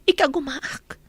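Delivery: normal talking voice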